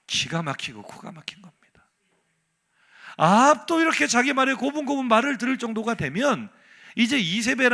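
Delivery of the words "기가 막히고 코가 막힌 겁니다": whispered dismay. Acted.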